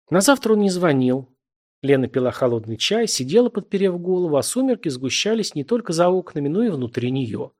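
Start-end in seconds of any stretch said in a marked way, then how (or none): none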